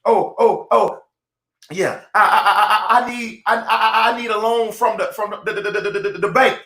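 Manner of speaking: stammering over I and the; stuttering excuse. Retold or imitated. Moses stammering that he needs a bank loan. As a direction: trip over the words nervously. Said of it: "that's your stuttering".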